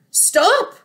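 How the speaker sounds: high, sudden command to halt; sharp order. A narrator's reading. Mister Fox halting the hungry animals, ordering sharply. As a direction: halt them with one high-pitched order. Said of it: "ordered Mister Fox".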